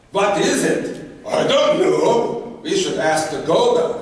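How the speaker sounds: loud, high-pitched oafish Viking; hammed-up dim-witted Viking bellow; dumb Viking boys. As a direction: shout it like a clueless big oaf. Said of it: "three really big, dumb Viking boys".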